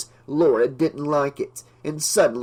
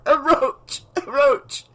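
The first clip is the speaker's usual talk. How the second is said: high, loud choking cry; panicked, gagging shout; choked panic; 12 semitones higher, 3 dB louder, slower than usual. A narrator's reading. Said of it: "Laura choked out".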